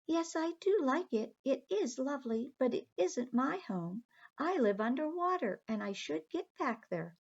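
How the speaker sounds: high, sweet little mermaid. Acted. Aqua the mermaid politely telling the children she must go home, high voice. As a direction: lift the voice up high for the tiny mermaid.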